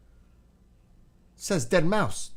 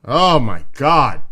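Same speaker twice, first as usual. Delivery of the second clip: freaked out gasp; 3 semitones higher, 12 dB louder, much slower than usual. Real, not acted. Reacting to giant spider images.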